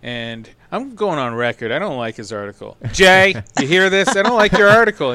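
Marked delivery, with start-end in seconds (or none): 2.9-5.1 louder and higher, calling Jay out directly. Real, not acted. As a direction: raise your voice to the writer who isn't there. Calling out absent writer Jay.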